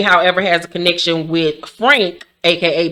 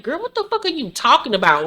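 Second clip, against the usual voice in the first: exasperated; 7 semitones higher, 4 dB quieter than usual. Retold or imitated. Her exasperated reaction.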